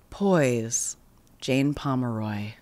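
low, quiet, measured mantra; centering mantra. Shown as a demonstration. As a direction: murmur it low to steady yourself. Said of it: "That kind of centers me".

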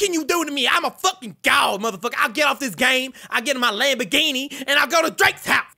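a put-on [name] voice, brash and cocky; [name] West impression. Trash-talking strangers in game chat, brash [name] voice. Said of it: "You guys wanna hear my real voice?".

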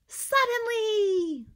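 drawn-out excited shout, high and loud. Shown as a demonstration. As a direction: belt it out with big excitement. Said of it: "you know our word that we need to say".

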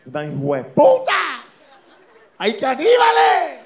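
slow build to a high, loud wail; panicked discovery. Acted. Man spots signs of cannibals, acted rising panicked scream. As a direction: stretch it out into a hammed-up cry of alarm.

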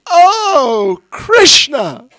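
high, loud exasperated outcry; frustrated cry. Retold or imitated. Fed-up auntie calling on Krishna over a crying baby, loud high outcry. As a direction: throw up your hands and cry out to God. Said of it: "She'd had enough".

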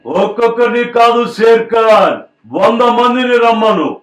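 high, loud, slow warrior challenge; Ram Charan impression. Mimic asked for Ram Charan, loud slow challenge. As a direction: roar the challenge slowly. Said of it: "Ram Charan గారి voice ఇప్పుడు".

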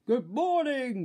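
loud, high booming greeting; hearty storybook shout; grocer's bellow. A narrator's reading. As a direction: bellow it at the customer. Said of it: "bellowed the grocer".

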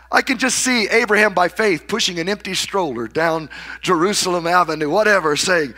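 ordinary talk

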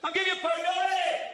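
high, loud exasperated shout; mock parent shout. Parent snapping at the kid about a pound, shouting. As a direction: bark at the kid over money.

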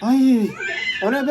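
ordinary talk, nothing marked

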